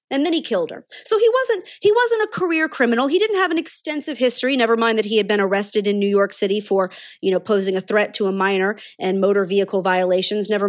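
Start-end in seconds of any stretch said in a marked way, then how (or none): 0.9-4.6 much higher, mock-defensive pitch; sarcastic excuse-making. Commentator parodying media excuses for a suspect, sarcastic.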